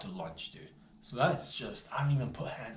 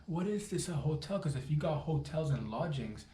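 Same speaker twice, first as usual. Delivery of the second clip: ordinary talk, nothing marked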